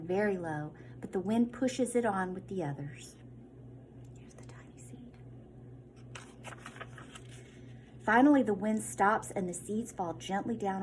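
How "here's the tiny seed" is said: slow hushed whisper; whispered aside. Real, not acted. Teacher pointing out the seed in a soft whisper. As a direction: breathe it to the class.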